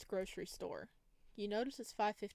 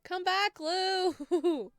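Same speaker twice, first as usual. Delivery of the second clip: much higher, much louder calls after him; pleading shout; 10 semitones higher, 11 dB louder, much slower than usual. Real, not acted. Player calling after Lou as he walks off, loud pleading shout. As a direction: cry out for him to come back. Begging character not to leave.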